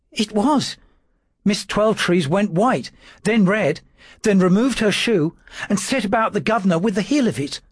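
high, thin timid clerk's voice; a frightened servant trying to sound firm; nervous little secretary. A narrator's reading. Dawes answering his lordship's questions, high and nervous.

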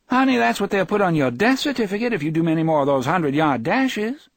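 high, quick wifely scolding without bite; affectionate nagging. A narrator's reading. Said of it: "The words were nagging, the tone was not".

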